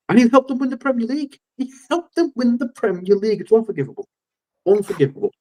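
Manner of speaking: mock outrage. Real, not acted. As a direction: rise up high in half-joking fan outrage. Outrage at Owen joining United.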